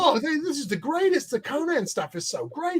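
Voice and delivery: high, gushing praise; Richard gushing. Retold or imitated. Richard praising the Conan work, high and gushing. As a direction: rave about it in a lifted voice.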